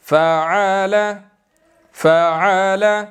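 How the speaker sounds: फ़अल said slowly and gently, no jerk; teacher models calm reading; calm, unstretched. Shown as a demonstration. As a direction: read फ़अल with ease. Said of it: "बिल्कुल आराम के साथ".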